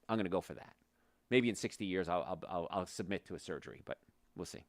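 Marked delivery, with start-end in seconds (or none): 1.3-4.6 said with a smile, trailing softer; smiling. Real, not acted. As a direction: grin through it. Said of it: "I'm smiling when I say that".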